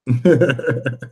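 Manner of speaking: short laugh after a joke; teasing laugh. Real, not acted. Host laughs after teasing Smurf, amused laugh. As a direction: laugh at your own ribbing.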